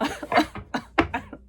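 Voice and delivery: real, mostly voiceless laugh. Real, not acted. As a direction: laugh it off after losing the thread. Laughs at own rambling.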